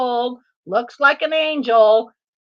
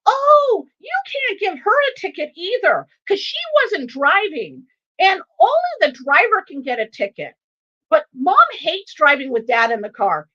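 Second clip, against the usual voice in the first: put-on outspoken young son; 5 semitones higher than usual. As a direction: blurt it out like a tactless six-year-old. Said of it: "[name] is at it again".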